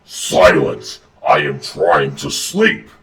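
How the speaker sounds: deep, slow, loud monster growl; angry roar. Acted. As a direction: roar it low like a beast woken from sleep. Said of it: "Conker hears an angry roar".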